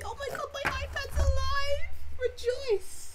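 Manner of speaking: high, loud squeal of joy; excited celebration. Real, not acted. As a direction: shriek in triumph, pitch shooting up. Celebrates iPad switching on.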